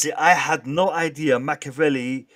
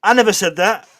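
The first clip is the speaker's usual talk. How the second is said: loud mock denial; 6 semitones higher, 8 dB louder than usual.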